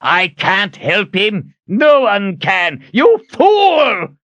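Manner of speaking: slow, high furious outburst; enraged. A narrator's reading. Zed slamming Kalen to the wall, enraged. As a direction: build to a loud furious cry. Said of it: "In a rage".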